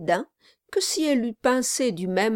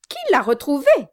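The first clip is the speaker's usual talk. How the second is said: eager question; 7 semitones higher, 7 dB louder, faster than usual. Acted. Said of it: "demanda vivement Sarah".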